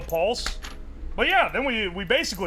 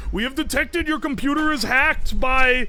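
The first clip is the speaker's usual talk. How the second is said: put-on a lightly done Indian accent, higher pitched scam caller; 5 semitones higher than usual. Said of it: "thickest Indian accent in the world".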